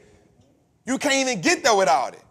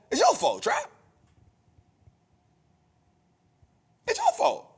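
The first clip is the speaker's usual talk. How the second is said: jumps much higher, pointed; blaming himself; 14 semitones higher, 3 dB quieter than usual. Retold or imitated. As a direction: throw the blame back on yourself, high. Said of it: "it's me telling myself".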